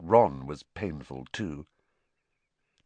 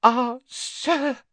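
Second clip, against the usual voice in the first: high, loud, tearful-elf gasp; 6 dB louder than usual. A narrator's reading. Dobby moved by Harry's escape, squeaky gasp. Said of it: "he gasped".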